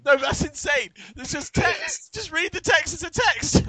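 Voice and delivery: high, sing-song mock exasperation; exasperated sing-song. Real, not acted. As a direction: chant it at them like they've lost it, pitch way up. Exasperated at reading in voices.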